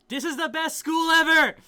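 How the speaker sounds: high, loud, thrilled shout; excited shout. Acted. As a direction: squeal it with delight. Character thrilled by dog professor.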